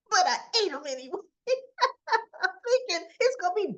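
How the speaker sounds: real laughter bubbling through the words; laughing. Real, not acted. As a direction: laugh at your own mistake as you tell it. Laughing at her own slip.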